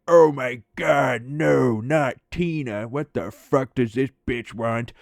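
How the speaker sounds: hammed-up dread at the phone ringing; imitating Mike. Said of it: "And Mike would be like".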